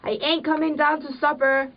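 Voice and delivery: the wife refuses to come down to supper; sulky refusal. Acted.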